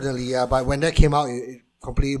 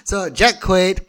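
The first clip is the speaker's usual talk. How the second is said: ordinary talk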